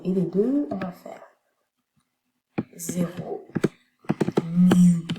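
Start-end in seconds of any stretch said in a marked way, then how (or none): 4.4-5.1 "mu" said alone, loud; secondary stress demo. Shown as a demonstration. Teacher marks the secondary stress in the stress pattern, loud. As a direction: punch out "mu" loudly on its own. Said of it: "accent secondaire".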